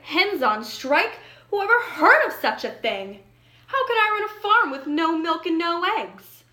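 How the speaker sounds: furious. Acted. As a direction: rail at the absurdity of striking hens. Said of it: "Farmer Brown was furious".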